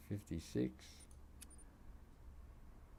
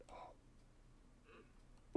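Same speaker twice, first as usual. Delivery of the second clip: whisper; 10 dB quieter than usual. Real, not acted. Whispered reaction.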